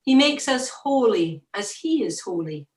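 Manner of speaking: ordinary delivery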